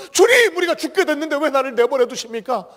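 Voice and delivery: loud, high anguished cry of the disciples; 절규하듯. Retold or imitated. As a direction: plead desperately to the Lord. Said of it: "이렇게 절규하지 않도록".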